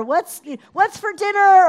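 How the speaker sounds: high, very loud call; shouting out. Retold or imitated. Wife asking husband in another room, shouting. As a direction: yell it through the house. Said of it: "Or I'll shout out".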